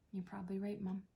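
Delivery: unvoiced, tender whisper; soft resigned whisper. Real, not acted. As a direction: barely breathe the words to her. Whispered tender reply to dying mother.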